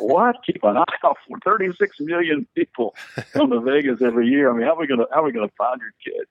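a lightly acted how-on-earth reaction; retelling his own baffled thought at the request; incredulous.

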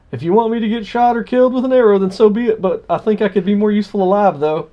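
lightly voiced naive missionary; mock missionary prayer. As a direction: read his prayer with mock piety.

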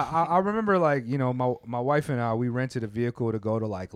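ordinary talk, nothing marked

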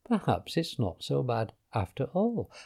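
quiet, half-convinced reflection; thinking aloud. A narrator's reading. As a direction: ponder it privately. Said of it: "she thought".

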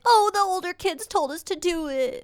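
put-on high tattling kid voice; child voice. Child cracking and blaming older kids in a high voice. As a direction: blurt it out like a little kid. Child confessing under pressure.

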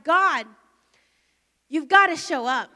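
a loud, pleading cry for help; desperate loud plea. Real, not acted. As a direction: demand it through tears. Crying alone in her car.

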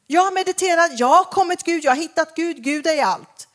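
mock enthusiastic seeker; lightly voiced seeker; imitating seekers. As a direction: play the confident new age believer. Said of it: "Känner ni igen det".

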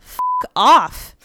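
loud, punchy brush-off; loud mock-annoyed retort; curt dismissal. A narrator's reading. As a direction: act out a rude rebuff. Partygoer's rude reply acted out.

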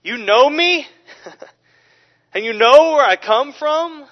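loud, high challenging call to the crowd; crying out loudly. Acted. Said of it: "he cried out as he taught in the temple".

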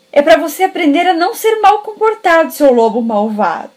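high, loud scolding; scolding grandma. Acted. Grandma scolding the wolf she just clubbed, loud and high. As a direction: tell the naughty wolf off sharply.

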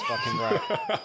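slow, high sung-out line; high drawn-out exclaim. Acted. Emphatic catchphrase.

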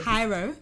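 Hiero를 크고 또렷하게 음절 끊어; 가르치듯 Hiero 천천히 강조; over enunciating. Shown as a demonstration. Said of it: "Just start one syllable at a time".

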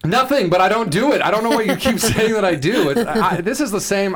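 high, loud denial with laughter creeping in; half-laughing exasperated objection; indignant protest. Real, not acted. Protesting friends' teasing about blow drying.

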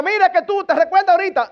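mock nagging. Parent dredging up an earlier fault, high mock nagging. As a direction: needle a child like a nagging parent.

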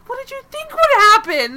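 high, loud, laughing incredulity; exasperated disbelief. Acted. Podcaster scolding the show's character, exasperated disbelief. Mocking the character's obvious mistake.

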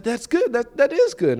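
high, lightly mocked pastor praise. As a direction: imitate his polite brush-off, up high. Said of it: "He said".

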